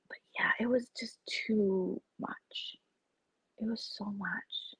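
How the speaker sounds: slow whisper, worn out; whispered overwhelm. Real, not acted. About the drama, tired slow whisper. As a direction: sigh it out in a whisper.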